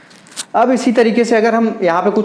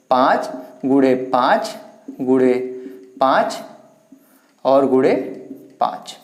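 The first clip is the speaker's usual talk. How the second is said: slow and low, spaced out while writing on the board; spelled out slowly, word by word; slow dictation; 5 semitones lower, 3 dB quieter, slower than usual. Shown as a demonstration. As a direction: drag out पाँच गुणे पाँच, pausing between each word. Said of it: "पाँच को अगर हम चार बार लिख देते हैं".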